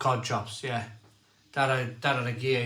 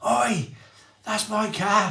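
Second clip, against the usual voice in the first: high, loud angry claim; retold shout; 8 semitones higher, 5 dB louder, slower than usual. Car owner confronts him at his car, shouting. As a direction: bark it out like he's caught a thief. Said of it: "he was going".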